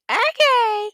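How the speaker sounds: loud, drawn-out, very high-pitched okay; catchphrase okay. Retold or imitated. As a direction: squeal out your signature catchphrase, high and loud. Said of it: "And when you say".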